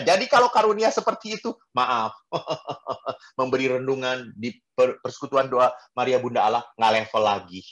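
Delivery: ordinary talk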